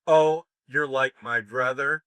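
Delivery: hammed-up friend-zone line, slow and loud; mock rejecting woman. Coach mimics a woman friend-zoning a man, slow and mocking. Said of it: "Have you ever heard that one?".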